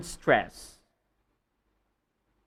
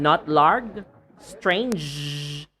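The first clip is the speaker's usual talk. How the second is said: normal talking voice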